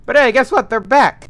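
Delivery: loud, high cheerful announcement; excited. Acted. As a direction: burst in with delight. Crew wakes after blackout.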